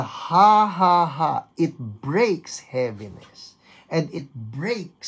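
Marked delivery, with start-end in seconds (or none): 0.0-1.3 loud, high, declared ha ha ha; deliberate released laugh. Shown as a demonstration. Preacher showing how releasing laughter breaks heaviness, loud deliberate laugh. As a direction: belt out the ha's on purpose. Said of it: "when you release the".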